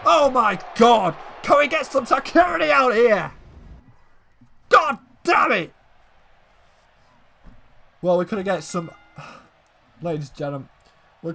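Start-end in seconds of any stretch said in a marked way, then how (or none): 0.1-5.7 furious, much higher and louder ringside yelling; outraged shouting. Acted. Commentator yelling for security after Reigns' attack, furious shout. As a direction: scream at security like the attack just happened in front of you.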